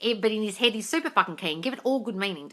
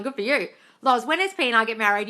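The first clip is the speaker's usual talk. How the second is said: ordinary talk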